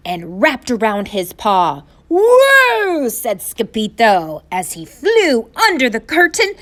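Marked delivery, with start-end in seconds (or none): 2.1-3.1 high, loud, stretched-out whoa; startled yell. A narrator's reading. Rope pulls kitten into shack, startled yell. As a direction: yelp it as if yanked away.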